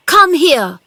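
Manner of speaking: high, loud command; shouting. A narrator's reading. Boy calling Dickon to help him, shouting. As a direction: call him over urgently. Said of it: "he shouted to Dickon".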